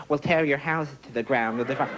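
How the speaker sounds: lightly put-on dictator's threat; mock tyrant voice, thrown in briefly; Saddam Hussein. Comedian guessing how a dictator speaks, light impression. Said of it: "I think he probably speaks like that".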